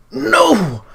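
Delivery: very high, loud anguished no; horrified cry. Acted. Lear watching Squid pick Skorbrand, horrified scream. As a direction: scream it out in horror.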